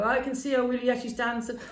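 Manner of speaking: high, animated retold report; excited lookout. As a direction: imitate a spy calling back what he sees.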